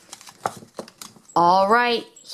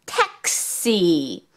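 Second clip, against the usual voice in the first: ordinary talk, nothing marked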